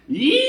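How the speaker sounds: full-throated imitation of a wild screech; imitated screech. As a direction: let out the creature's shriek as loud as you can. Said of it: "It went something like".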